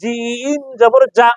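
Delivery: long-held জিম, then a hard j on যা; slow, firm জিম জবর যা; firm jim. Shown as a demonstration. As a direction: stretch the vowel of জিম and press যা from mid-tongue. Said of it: "শক্তভাবে আদায় হয়".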